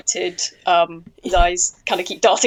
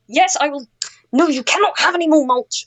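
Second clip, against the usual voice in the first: mock yelling at plant; 6 semitones higher than usual. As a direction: snap at a greedy plant mid-conversation. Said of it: "yelling at the plant".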